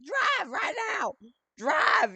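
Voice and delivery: frantic mom yelling from the passenger seat; imitating mom screaming. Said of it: "screaming it".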